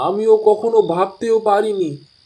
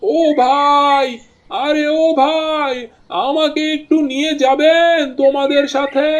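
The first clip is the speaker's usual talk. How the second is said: calling out; 6 semitones higher than usual. Acted. Stranger calls boatmen from afar.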